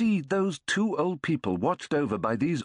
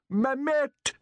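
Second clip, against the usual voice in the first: much higher call across the house; 13 semitones higher than usual. A narrator's reading. Old man calling his wife to come meet the guest.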